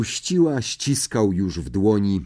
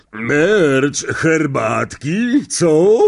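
higher, jovial host offering tea; hearty uncle; 7 semitones higher, 6 dB louder, much slower than usual. A narrator's reading. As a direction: play the beaming uncle. (wesoły wujek częstuje herbatą)

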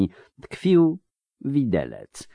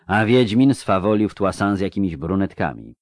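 usual speaking voice

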